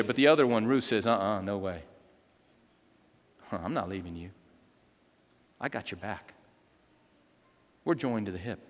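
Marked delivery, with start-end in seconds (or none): 1.1-8.6 low, soft, intimate promise; hushed, steadfast vow; quiet loyalty. Acted. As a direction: reassure her gently with pauses between phrases. Ruth's loyal promise, voiced softly.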